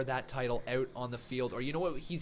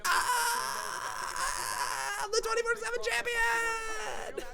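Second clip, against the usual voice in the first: much higher, crying-out boast of Gronk; mock Gronk brag; slower than usual. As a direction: squeal it like a showboating football player. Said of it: "he just go".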